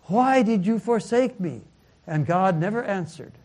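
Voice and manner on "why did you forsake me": loud, raised-pitch retold cry. Dying son to his father on the cross, crying out. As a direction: call it out, imitating a forsaken son. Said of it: "he cried out".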